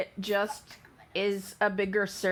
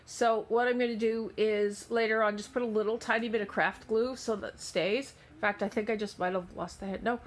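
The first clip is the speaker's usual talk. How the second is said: ordinary delivery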